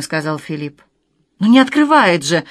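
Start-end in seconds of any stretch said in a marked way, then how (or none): 1.4-2.4 higher, louder burst of alarm; storyteller's contained panic; panicked cry. A narrator's reading. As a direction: cry out in sudden unexplained dread. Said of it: "в приступе какого-то необъяснимого ужаса выкрикнула".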